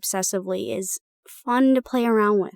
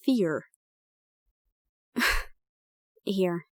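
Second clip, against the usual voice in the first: usual speaking voice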